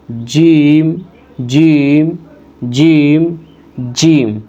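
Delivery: "جیم" with the tongue pressed to the palate; letter demo. Shown as a demonstration. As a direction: model "جیم" firmly. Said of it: "جیم بولنے کا طریقہ یہ ہے".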